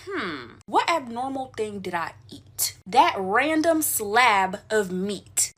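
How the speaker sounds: slow, drawn-out pondering; exaggerated slow-dawning thought; thinking to oneself. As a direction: piece it together word by word, very slowly. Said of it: "I'm thinking to myself".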